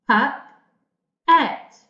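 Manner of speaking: Pup-et said in two loud, separate beats; teacher sounding out Pup-et; split into syllables. Shown as a demonstration. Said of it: "I have two syllables".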